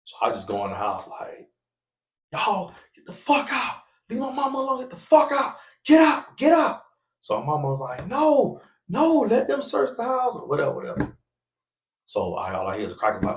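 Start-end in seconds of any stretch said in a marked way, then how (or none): 2.3-6.8 high, tearful get the fuck out; retold crying yell; angry yelling. Throwing police out, crying. 8.1-10.4 pleading mother. Retold or imitated.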